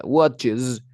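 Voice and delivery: "watches" with a buzzing z at the end; teacher's model "watches" closing on a hummed z; final Z sound. Shown as a demonstration. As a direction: voice the last s of "watches" as z. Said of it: "sonido de Z".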